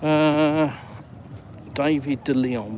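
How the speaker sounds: ordinary talk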